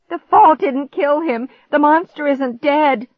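high, loud, slow shriek; drawn-out cry of terror. A narrator's reading. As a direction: shriek the news in panic. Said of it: "I shrieked".

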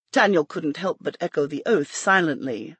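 ordinary delivery

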